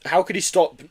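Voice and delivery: ordinary delivery, nothing marked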